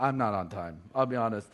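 low, quiet confession; sheepish admission. Shown as a demonstration. Sheepish admission about home habits.

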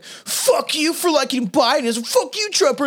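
a hammed-up angry little kid; mocking Trump kid. Mocking a Trump-loving kid yelling at a classmate, bratty high voice. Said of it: "Trump kids being like".

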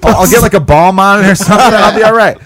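mock self-reassuring patient. Burned man skipping the hospital, hammed-up self-reassurance. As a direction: imitate someone insisting they are fine. Same acted patient shrugging off burn.

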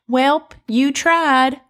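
put-on high, drawn-out sweet-granny accent; sweet old grandma. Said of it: "I just did that with that accent".

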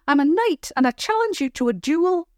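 put-on high, defiant girl's voice; fierce little girl. A narrator's reading. Little girl snapping at her brother, fierce high voice. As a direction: stamp it out like a cross child. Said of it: "the girl said fiercely".

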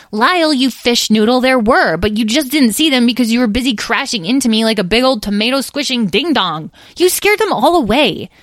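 put-on high, loud bickering child; indignant squabbling. Edwin blames Lyle for scaring the mermaids, indignant. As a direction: rattle off insults and blame. Sibling scolds brother with insults.

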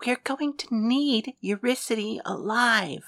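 slow, soft whisper. A narrator's reading. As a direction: whisper it close to his ear. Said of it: "pulled Hades down to whisper to him".

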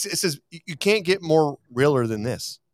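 ordinary delivery

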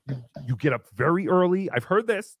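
ordinary talk

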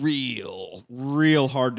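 REAL stretched out very slowly; drawn-out emphasis. Real, not acted. Podcaster mocking the film's effort, long stretched "real". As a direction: lean long and hard on each "real".

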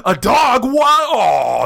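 high, loud cartoon astonishment; hammed-up excitement. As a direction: squeal it, then drop into a loud mock groan. Acts the fooled cartoon character.

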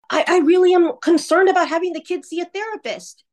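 high-pitched, stammered protest; acted-out urgent concern; mock worried objection. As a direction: imitate an ex objecting in court, pitch jumping up. Ex objects in retold court scene.

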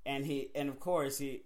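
ordinary delivery

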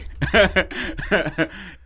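high burst of real laughter. Real, not acted. Speaker laughs at the interruption.

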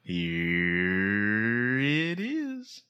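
very slow, gliding upward; drawn-out flourish. Pretend. Host cueing the guest after the introduction, drawn-out rising flourish. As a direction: stretch it out in one long rising swoop.

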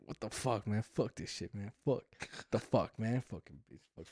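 low, very soft imitated grumble; mumbling to himself. Imitating sleepy friend's grievance in the car, muttered to himself. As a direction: grumble under your breath, as if nobody hears. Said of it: "start mumbling to himself".

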